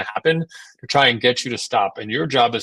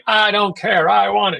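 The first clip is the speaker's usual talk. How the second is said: high, loud mock tantrum yell; imitating a screaming toddler refusing; 9 semitones higher, 4 dB louder than usual. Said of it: "the toddler just screaming".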